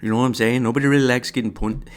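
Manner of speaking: mock Canadian fighter twang, laughing at yourself; failed Canadian twang. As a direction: try a Canadian twang and crack up. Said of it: "I can't freaking do the Canadian".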